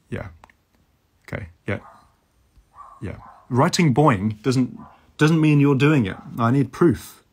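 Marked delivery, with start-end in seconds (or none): 3.5-7.2 loud, slow insistence; emphatic demand. Real, not acted. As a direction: insist loudly, word by word. Demanding proof from viewers.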